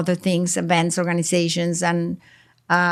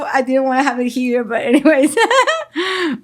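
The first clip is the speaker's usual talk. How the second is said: giggling through the words, voice jumping higher and louder; bubbling over with laughter at the surprise; laughing while talking; 6 semitones higher, 4 dB louder than usual. Real, not acted. Guest laughs while surprising the host with a gift, giggling.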